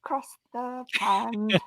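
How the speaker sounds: put-on slow, soft stab at an Irish accent; attempted Irish accent. Co-host joking about crossing the pond, attempted Irish accent. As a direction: draw out a hesitant Irish accent. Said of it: "this is my Irish accent".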